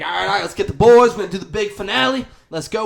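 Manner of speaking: loud, fast hype; rally the boys fast and loud; pumped-up boss. Retold or imitated. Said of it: "he's like".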